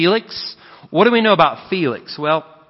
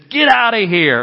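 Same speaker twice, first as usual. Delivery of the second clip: louder, higher shouted order; angry yell; 6 semitones higher, 5 dB louder than usual. Retold or imitated. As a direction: bark him out of the car. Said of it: "and he says".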